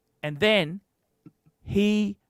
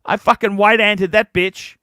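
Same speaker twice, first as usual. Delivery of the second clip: fired-up loud boast; 2 semitones higher, 10 dB louder than usual. Real, not acted. Bragging to Jamie about beating the family, loud fierce boast. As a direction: crow about it, full volume and fierce.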